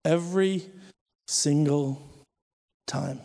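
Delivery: each word set apart by long pauses; slow emphasis. Real, not acted. Driving home the point, slow with long pauses. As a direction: drop the words one at a time.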